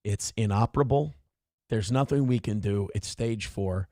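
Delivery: low, soft, hesitant mock doctor; somber bad news. Doctor telling family grandmother has cancer, subdued and apologetic. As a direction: break it gently, with halting pauses.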